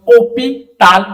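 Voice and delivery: teacher modelling a drawn-out, forceful "ओपी ताल"; stretched, pressed vowel. Shown as a demonstration. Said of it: "एकदम बिल्कुल खींच दबा के साउंड देते हैं".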